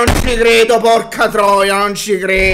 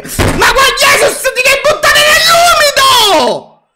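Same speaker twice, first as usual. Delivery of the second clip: exasperated yell; 9 dB louder, much slower than usual. Real, not acted. Fan yells at defender.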